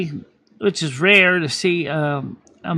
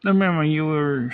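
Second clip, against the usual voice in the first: ordinary talk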